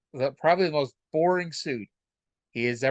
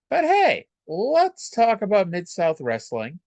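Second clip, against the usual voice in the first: excited; 7 semitones higher, 4 dB louder than usual. Real, not acted. Upbeat pivot to show topic.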